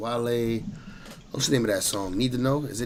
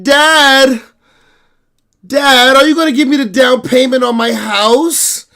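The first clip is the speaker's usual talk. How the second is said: put-on high, pleading daddy's-girl voice, louder; whiny daughter; 9 semitones higher, 6 dB louder, slower than usual. Daughter begging dad for down payment, high whiny plea. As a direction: beg dad for house money like a spoiled daughter. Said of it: "she be like".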